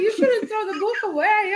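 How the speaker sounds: high, pleading mock protest; dismayed. Wife protests husband trashing book, mock dismay. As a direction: re-enact pleading for the book, pitch way up.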